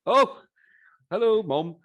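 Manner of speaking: loud, high-pitched surprised hello; surprised, jumping up high and loud; delighted surprise. Real, not acted. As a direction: greet an unexpected guest with a big, high "oh". Surprised greeting to guest's mother.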